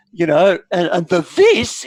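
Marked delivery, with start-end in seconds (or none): 1.4-1.8 high, loud echo of the word; shocked this. Acted. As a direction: mimic the horrified this. GM repeats mother's word.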